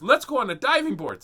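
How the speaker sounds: put-on high, loud eager kid voice; Kyler. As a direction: pipe up like an excited child. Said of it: "And he goes".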